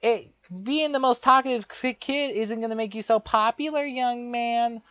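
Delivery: high, lightly put-on scolding teacher voice; mock prissy teacher. Teacher scolding the talkative kid, mocking high voice. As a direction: lecture him like a smug teacher. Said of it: "she would just say".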